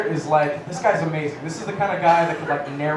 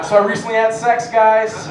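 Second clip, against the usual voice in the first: higher, louder joke voice pretending to be normal; a bit louder than usual, comic mock voice; put-on joke voice; 4 semitones higher, 8 dB louder than usual. Shown as a demonstration. Comic tells crowd it's his normal voice, mock put-on. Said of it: "that's what my normal voice sounds like".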